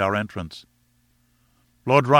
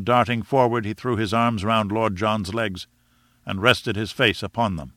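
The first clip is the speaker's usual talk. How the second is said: ordinary delivery, nothing marked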